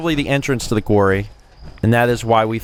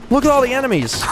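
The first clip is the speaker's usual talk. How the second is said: ordinary talk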